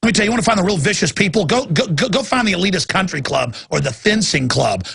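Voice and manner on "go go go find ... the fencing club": ordinary talk, nothing marked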